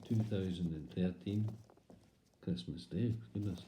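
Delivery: ordinary talk, nothing marked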